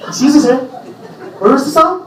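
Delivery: high, nagging customer voice; high-pitched diner catching him eating; mock fussy customer. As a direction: call out to the waiter sharply. Customer calling to waiter.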